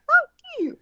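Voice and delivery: high mock snarl at the other driver; mimicked angry yell. The Camaro driver cursing at the woman who honked, mock angry. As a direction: throw it back like the angry woman did.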